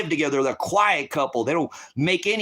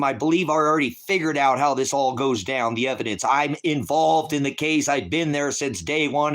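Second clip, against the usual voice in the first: normal talking voice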